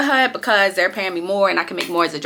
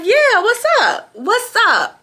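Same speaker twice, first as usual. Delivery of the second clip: high, slow swaggering what's up; cocky brag; 13 semitones higher, much slower than usual. Retold or imitated. Reliving bragging to the Hardee's hiring staff, slow cocky swagger. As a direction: strut it, much higher. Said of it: "I talked my shit".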